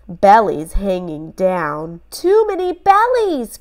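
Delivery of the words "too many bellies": put-on high boy voice crying out; exasperated little boy. A narrator's reading. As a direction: Ben crying out among the crowd. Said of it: "cried Ben".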